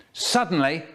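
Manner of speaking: usual speaking voice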